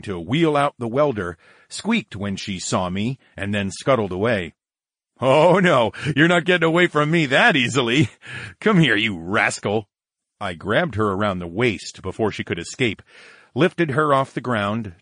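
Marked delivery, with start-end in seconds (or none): 5.2-9.9 higher, louder teasing call; lively raised voice; playful chasing. Acted. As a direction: call after someone in mock warning. Playful chase after Valerie.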